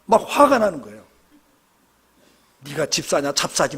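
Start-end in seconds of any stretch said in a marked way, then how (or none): 2.6-3.8 mocking jab. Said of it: "막 이래요, 막".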